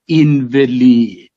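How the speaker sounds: "Invalid" said slowly as in-vuh-LEED; stress shift in invalid. Shown as a demonstration. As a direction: draw out a long LEED in "Invalid". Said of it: "there is a shift of stress".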